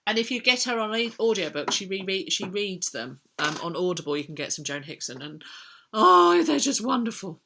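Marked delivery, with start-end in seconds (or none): 5.9-7.4 high, loud swoon of admiration; gushing delight. Real, not acted. Fan recommending Joan Hickson's audiobooks, gushing. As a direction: burst out with real rapture, easing as it goes.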